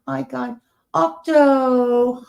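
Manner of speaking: ordinary delivery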